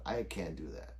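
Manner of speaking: normal talking voice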